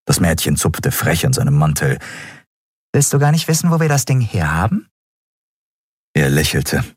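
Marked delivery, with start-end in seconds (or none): 2.9-4.9 put-on high, teasing girl's question; cheeky girl. A narrator's reading. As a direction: needle him playfully in a higher voice. (freches Mädchen, hohe Stimme)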